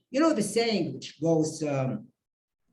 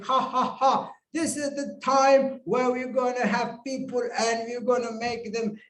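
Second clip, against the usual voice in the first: gloating, higher voice after a mock laugh; table topic master; 4 semitones higher than usual. Table topic master about to give people butterflies, mock gloating. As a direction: laugh "ha ha ha", then announce with relish. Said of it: "table topic master starts saying".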